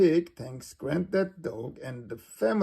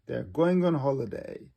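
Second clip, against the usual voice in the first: ordinary delivery, nothing marked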